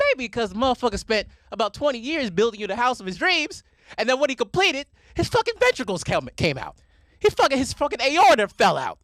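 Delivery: loud, high-pitched comic rant. Real, not acted. Ranting about why grandpa died, loud and worked up. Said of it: "it's a rant, right?".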